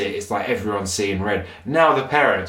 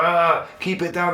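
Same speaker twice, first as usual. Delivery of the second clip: higher, mildly annoyed hush; mock complaint; 9 semitones higher than usual. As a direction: imitate an irritated stranger. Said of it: "people are like".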